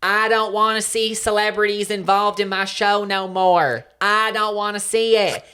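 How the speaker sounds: loud, high, slow country rant; country accent. Pretend. Fed up with celebrities on show, country accent. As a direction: declaim it with a country twang. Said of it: "I can do a country accent".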